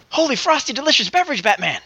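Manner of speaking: a hint of Robin's excitement. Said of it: "Batman utility belt".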